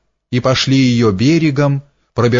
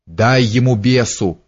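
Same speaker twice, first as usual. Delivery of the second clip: ordinary delivery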